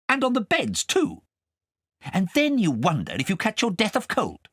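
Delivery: scolding, high and sharp; cross governess. Acted. Governess scolding Kay over pork pie, cross. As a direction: tell him off like a strict governess.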